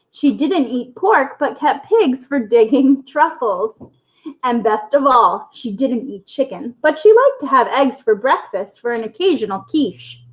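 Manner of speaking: ordinary delivery, nothing marked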